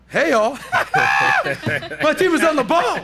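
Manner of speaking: high, loud laughing shout of delight; excited shout. Real, not acted. Host laughing and shouting as his crew cuts to another camera. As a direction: whoop it out, thrilled at the team.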